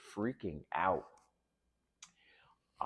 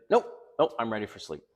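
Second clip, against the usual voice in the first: higher, louder flat nope nope; imitating her refusal; 4 semitones higher, 7 dB louder, faster than usual. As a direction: mimic her brushing him off. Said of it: "She's like".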